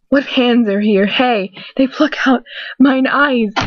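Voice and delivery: lightly dramatic Shakespeare reading; a touch of tragic actor on the line; Macbeth quote. Acted. Narrator opening with Macbeth, lightly dramatic. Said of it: "William Shakespeare, Macbeth".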